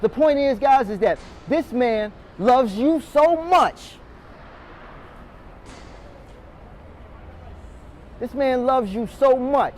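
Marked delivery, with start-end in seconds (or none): 1.5-9.7 slow emphasis. Real, not acted. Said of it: "emphatic repeat, slowed".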